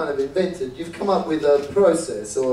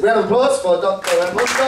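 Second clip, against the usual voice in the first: louder and higher, rallying the room; calling for applause; 5 semitones higher, 5 dB louder than usual. Real, not acted. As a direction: pump up the crowd for the guest. Host calls for applause.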